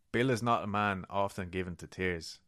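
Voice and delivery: ordinary talk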